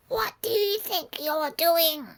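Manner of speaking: high, loud, slow shrieking witch; shrill old witch demanding an answer. Acted. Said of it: "Trying to do a wi-, a witch voice there".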